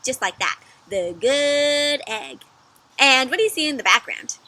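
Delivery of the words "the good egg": slow drawn-out title. Shown as a demonstration. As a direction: stretch each word slowly. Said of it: "Can you say it with me".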